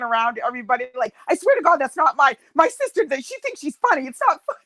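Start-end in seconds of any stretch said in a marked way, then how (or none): 1.3-4.6 high, flustered mock denial; acted-out indignation, breaking into a laugh at the end; embarrassed protesting. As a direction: imitate a mortified sister appealing to bystanders. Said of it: "She was all embarrassed".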